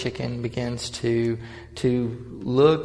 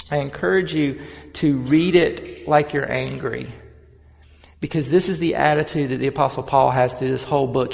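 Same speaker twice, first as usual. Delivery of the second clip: preaching; 2 semitones higher, 4 dB louder than usual. Real, not acted. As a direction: preach it with rising fire. Preaches on Galatians with force.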